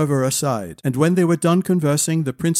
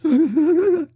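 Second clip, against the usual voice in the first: high, noisy drawn-in breath; acted deep breath; 14 semitones higher than usual. Cower breathing along with Malady, exaggerated. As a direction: suck in air audibly. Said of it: "Deep breath".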